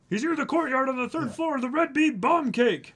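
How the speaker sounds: high loud urgent guard call; squeaky guard report. Acted. Guard shouting where the intruder is.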